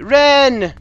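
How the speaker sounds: high, loud name call. Acted. Child calling to a missing sister, urgent shout. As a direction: holler the name across a distance.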